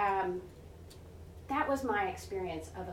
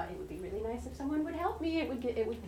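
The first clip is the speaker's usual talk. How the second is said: mock nagging; 3 semitones higher, faster than usual. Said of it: "futzing at the children".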